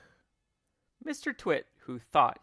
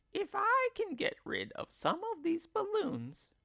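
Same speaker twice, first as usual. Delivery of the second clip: wife thinking aloud. Acted. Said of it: "she said to herself".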